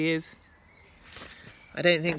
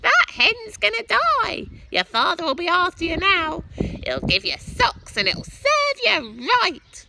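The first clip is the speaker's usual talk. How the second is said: shouting Grandma; 7 dB louder than usual. A narrator's reading. Grandma shouting from the roof that the hen will die. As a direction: scold the boy at full shriek. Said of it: "Grandma shouted".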